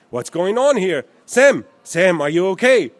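loud, alarmed American English. A narrator's reading. American reaching his collapsed friend, loud American English. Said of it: "in breitem Amerikanisch".

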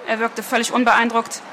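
plain reading voice

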